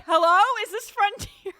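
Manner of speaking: high, loud laughing impression of a desperate passenger; mock frantic caller. Couple grabbing the airport intercom, high laughing mock panic. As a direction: ham up a panicked traveler on the intercom. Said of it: "and they're like".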